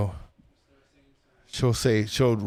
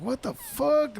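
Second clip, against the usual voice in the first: stunned disbelief; 10 semitones higher, 4 dB quieter, slower than usual. Retold or imitated. Retold shock, turned away at bar.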